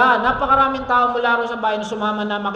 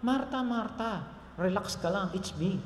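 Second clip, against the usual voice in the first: soft reassurance; 3 semitones lower, 13 dB quieter than usual. Pretend. Jesus voiced reassuring softly.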